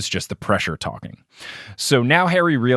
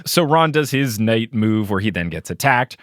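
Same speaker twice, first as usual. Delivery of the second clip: ordinary talk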